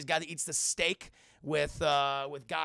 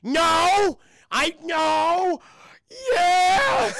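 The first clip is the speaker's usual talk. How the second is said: hammed-up wail, much higher and louder; breaking into tears; 10 semitones higher, 10 dB louder, much slower than usual. Penguin confesses he was fired, mock crying wail. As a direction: deny it, then collapse into mock bawling. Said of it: "He starts crying".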